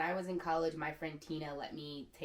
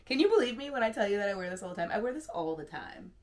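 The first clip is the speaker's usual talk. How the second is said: amused disbelief; 3 semitones higher, 7 dB louder than usual. Real, not acted. Amused at her own claim.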